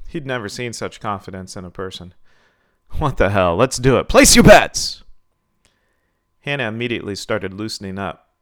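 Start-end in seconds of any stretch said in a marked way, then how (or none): 3.0-5.0 announcing to crowd. A narrator's reading. Promoter calls for bets, building to a big shout. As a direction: give in, then holler it to the crowd.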